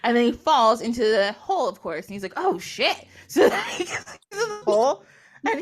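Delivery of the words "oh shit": startled. Acted. Leopard falls into the hole.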